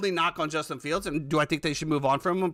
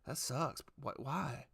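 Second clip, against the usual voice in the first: mopey shrug; 6 semitones lower, 12 dB quieter, slower than usual. Retold or imitated. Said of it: "he's gonna be like".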